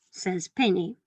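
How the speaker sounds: ordinary talk, nothing marked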